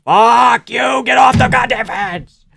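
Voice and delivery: loud, high real yelling at the screen; furious fan shouting at stalling fighters; angry shout. Real, not acted. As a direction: bellow in real frustration at the fighters.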